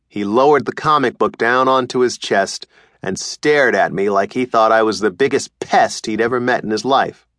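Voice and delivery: ordinary delivery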